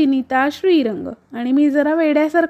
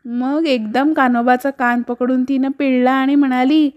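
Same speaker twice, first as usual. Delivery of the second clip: plain reading voice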